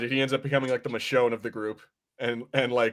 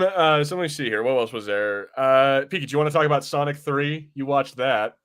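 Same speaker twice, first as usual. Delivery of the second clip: ordinary delivery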